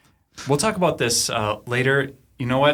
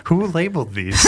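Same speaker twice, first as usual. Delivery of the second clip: higher voice breaking into laughter; laughing; 11 semitones higher, 5 dB louder than usual. Real, not acted. Player amused by the mislabeled bottle, laughing. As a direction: ask it through real laughter.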